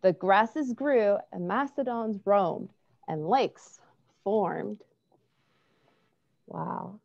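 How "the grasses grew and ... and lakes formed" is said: gentle storybook narration; reading to children. A narrator's reading. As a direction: read it like bedtime story. Reads a picture book aloud.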